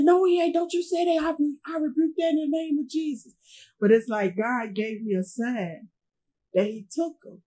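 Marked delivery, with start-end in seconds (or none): 0.0-3.3 much higher, urgent and rising in protest; grandma's alarmed denial. Retold or imitated. Grandma refusing to hear her grandson died, alarmed and high. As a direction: rebuke it like a praying grandmother refusing the news. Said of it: "She said".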